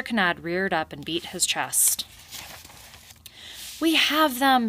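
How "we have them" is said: high, loud victory shout; triumphant cry. A narrator's reading. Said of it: "he cried triumphantly".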